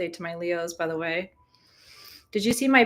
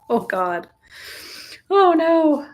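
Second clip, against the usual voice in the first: mock alarm; 9 semitones higher, 6 dB louder, slower than usual. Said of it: "It's like".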